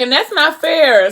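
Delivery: high, drawn-out playful whine; mock protest. Acted protest in play fight.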